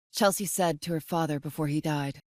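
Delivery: ordinary delivery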